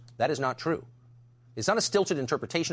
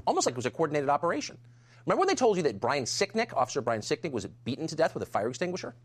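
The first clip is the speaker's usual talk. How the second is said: usual speaking voice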